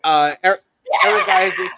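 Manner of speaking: loud call across the room. Real, not acted. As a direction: shout the name to get attention. Calling out a chat name.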